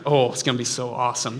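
usual speaking voice

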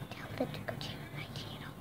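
whispered prompt to a friend. Real, not acted. Kid whispering a cue to her friend on camera.

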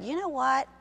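sweet, lulling temptation voice; lying inner voice. Retold or imitated. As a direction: coax like a sly voice in your head. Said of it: "And that voice said".

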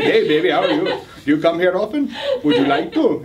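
hammed-up Indian accent pickup line; comic come-on with an Indian accent. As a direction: flirt in a mock Indian accent.

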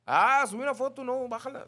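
high, loud put-on voice; mock fussy person. Host imitating an imagined complainer in a high voice. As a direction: play an offended prude. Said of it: "no es que alguien diga".

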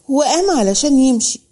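plain reading voice

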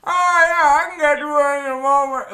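high, loud, mouth-full garble; mouth stretched wide. Acted. Snake chats while swallowing a human, mouth stretched wide. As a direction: talk round a mouth distended by prey. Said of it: "Your mouth is distended as you're like".

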